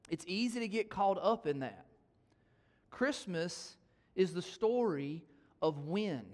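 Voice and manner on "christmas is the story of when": slow emphatic. Acted. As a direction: draw each word out slowly. Slow sermon point.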